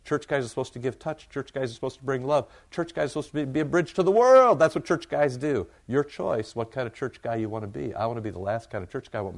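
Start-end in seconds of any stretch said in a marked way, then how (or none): 0.1-5.7 rising from eager to ringing and loud; building fervor. Retold or imitated. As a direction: swell into a loud rallying cry. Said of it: "you could have the reaction of".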